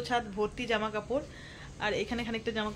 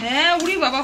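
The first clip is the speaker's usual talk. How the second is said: higher and louder, amazed; surprised exclamation; 6 semitones higher, 9 dB louder than usual. Real, not acted. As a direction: burst out in disbelief. Reacting to tiny soybean portion. (অবাক হয়ে চেঁচিয়ে ওঠা)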